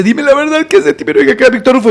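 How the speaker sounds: high, urgent questioning; imitated urgent demand. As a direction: demand it like a desperate man. Captor's frantic demand retold again.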